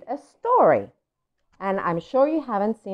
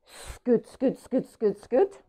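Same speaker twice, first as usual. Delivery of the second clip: light, higher scoot scoot patter; scooting sound; 5 semitones higher, slower than usual. Acted. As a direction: tap out the little running steps. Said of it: "That's the sound he makes".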